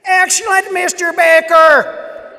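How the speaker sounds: high, loud Wisconsin praise, slowing on the name; excited Wisconsin-accented shout of praise. Retold or imitated. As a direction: boom it out like a Wisconsin professor. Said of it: "he said in his Wisconsin accent".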